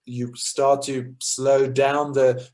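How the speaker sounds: slow, running-out-of-fuel delivery. Shown as a demonstration. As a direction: drag it out like an empty tank. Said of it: "think about a car running out of petrol".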